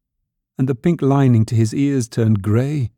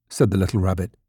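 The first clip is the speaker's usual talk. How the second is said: plain reading voice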